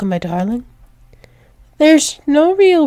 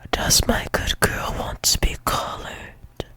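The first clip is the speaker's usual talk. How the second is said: soft, teasing whisper; 10 dB quieter than usual. Acted. Partner offers good girl a collar, whispered. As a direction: whisper the question close and low-key.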